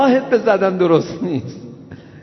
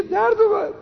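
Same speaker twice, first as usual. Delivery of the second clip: put-on very high, squeaky childish whine; mock the pupil's complaint at its most childish; exaggerated whine. Pupil squealing to the teacher that it hurt, very high mock whine.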